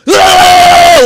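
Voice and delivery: sudden high, loud mock yell into the camera; drunk yell at camera. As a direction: bellow a wordless yell at the lens. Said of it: "like this, right?".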